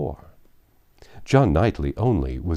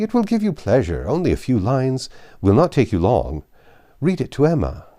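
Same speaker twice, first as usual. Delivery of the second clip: higher, louder, eager and pressing; eager urging; 5 semitones higher, 4 dB louder than usual. Acted. Husband urging his wife to read Frank's letter, eager. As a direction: push the letter on her gladly. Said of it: "said he".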